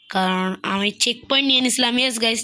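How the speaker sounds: ordinary talk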